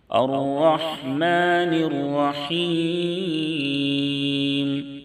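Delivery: slow, high, melodic tajweed chant; slow melodic Quran recitation. Shown as a demonstration. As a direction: draw each vowel out in a sung recitation. Recites verse after explanation.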